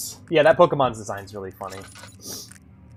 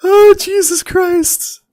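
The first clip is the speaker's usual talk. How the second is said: shaken relief; 13 dB louder, much slower than usual. Real, not acted. Relief after escaping.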